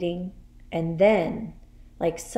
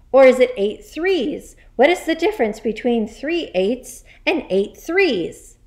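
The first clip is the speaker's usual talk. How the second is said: loud, high, furious tirade; yelled; 6 semitones higher, 9 dB louder than usual. Acted. Headmistress berates boy over multiplication, yelling. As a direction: keep yelling, hammering the lesson home. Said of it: "yelled the Trunchbull".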